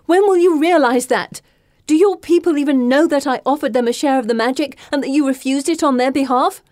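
high, loud furious questions; angry shouting. Acted. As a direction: keep yelling at her. Continues shouting at Asha.

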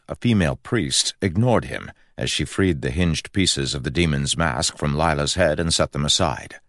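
usual speaking voice

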